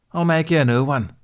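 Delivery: curt seamstress promise; curtly. Acted. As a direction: brush him off with the offer. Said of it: "she said curtly".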